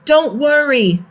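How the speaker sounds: aunt shouting to the family that grandma is safe; shouted reassurance. A narrator's reading. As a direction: call across the street to calm them. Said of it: "waved and shouted".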